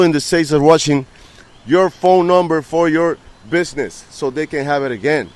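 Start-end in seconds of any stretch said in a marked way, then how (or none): none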